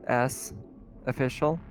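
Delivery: normal talking voice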